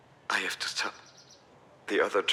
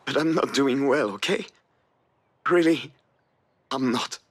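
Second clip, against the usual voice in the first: pleading distress; 7 semitones higher, 6 dB louder than usual. Acted. Alex pleads he's not doing well.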